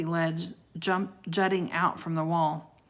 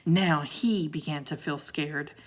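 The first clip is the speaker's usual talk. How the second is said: ordinary delivery, nothing marked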